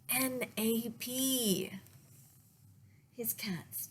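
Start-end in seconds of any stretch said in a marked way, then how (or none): 0.1-1.7 slow, letter by letter; spelled out. Acted. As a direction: spell it deliberately. Nick spells slowly.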